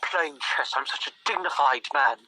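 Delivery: lightly put-on dignified gentleman; a touch of hammed-up pomposity; mock pretentious. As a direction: play up the self-important chess lover a little. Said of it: "I know this sounds kind of pretentious".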